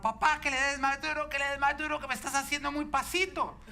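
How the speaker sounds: high, loud mock tantrum, laughing at the end; boy scolding his dad from the swing, half laughing; imitating angry child. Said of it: "empezaba a gritar bravo".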